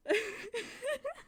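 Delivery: high, loud giggly voice; laughing. Real, not acted. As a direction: speak through the giggles. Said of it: "I literally can't stop laughing".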